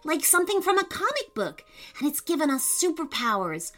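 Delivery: high, breathless-with-news enthusiasm; excited explaining. A narrator's reading. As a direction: gush out your theory to your sister. Boy excitedly explains superpowers.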